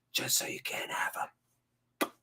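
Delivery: slow, voiceless whisper; mock-spiteful whisper. Streamer gloating over his imaginary team, whispering. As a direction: whisper it like a petty secret.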